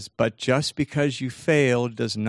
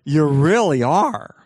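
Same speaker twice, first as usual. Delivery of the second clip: slow, loud, drawn-out echo of praise; big, swooping mock affirmation; brain gushing agreement; 3 semitones higher, 8 dB louder, slower than usual. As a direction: stretch "really are" wide in hammed-up agreement. Brain echoes the self-praise emphatically.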